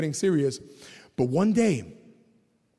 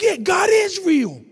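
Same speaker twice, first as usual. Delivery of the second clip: high, loud and slow, God is real; fervent shout; 12 semitones higher, 5 dB louder, slower than usual. Real, not acted. Preacher shouting to the men that God is real. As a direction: proclaim it with full force.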